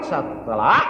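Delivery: normal talking voice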